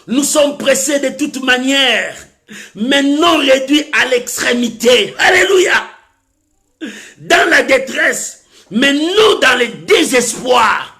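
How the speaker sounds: slow, ringing Bible proclamation; declaimed scripture. Real, not acted. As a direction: stretch each phrase out for the congregation.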